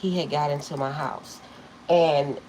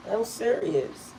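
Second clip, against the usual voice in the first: ordinary talk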